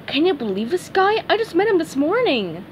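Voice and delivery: high-pitched, chatty fish lady; high, amazed character voice; blue fish character. Acted. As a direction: confide it in a high put-on voice. Blue fish confides in Patrick.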